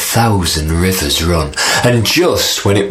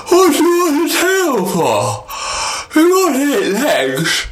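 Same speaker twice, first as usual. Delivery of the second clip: sky king. Acted. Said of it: "Nwame said".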